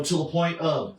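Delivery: ordinary talk, nothing marked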